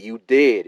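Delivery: "You did" punched hard and loud; shout-read "You did" as typed in caps; all-caps emphasis. Shown as a demonstration. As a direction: hit "did" like it's in capitals. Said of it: "in capital letters".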